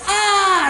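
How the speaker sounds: high loud Ah scream; mock scream. Burma screaming at the gnomes, high loud Ah. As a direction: let out a sudden high Ah.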